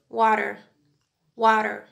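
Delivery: American "wadder" with a sounded final R; American pronunciation. Shown as a demonstration. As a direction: demonstrate American "water", tapped T and R ending. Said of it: "In American dialect".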